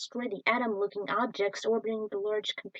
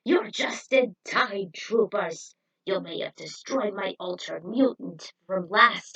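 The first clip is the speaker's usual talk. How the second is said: sneering villain voice, drawn out; gloating villain; 3 dB louder than usual. Acted. Villain Eyespot gloating at the troopers, sneering. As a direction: gloat over the cornered heroes.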